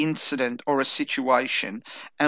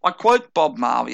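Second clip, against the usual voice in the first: ordinary delivery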